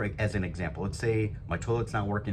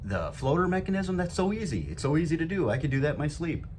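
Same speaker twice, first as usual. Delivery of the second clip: higher, lightly voiced smug listener; mock know-it-all; 7 semitones higher than usual. Host imitates listeners who find the repair easy, mildly smug. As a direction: brush it off as easy, a touch smug. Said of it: "some of you might be listening and say".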